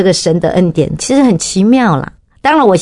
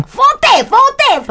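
put-on much higher, Chinese-accented "day" stressed; 中式英文，很得意; proud broken English. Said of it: "我就讲".

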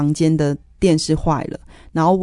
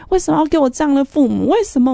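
high, anguished yell at the ceiling; 重现当时大喊：为什么; retold yelling; 10 semitones higher, faster than usual. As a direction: cry out to heaven in despair. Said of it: "我就在里面大喊大叫".

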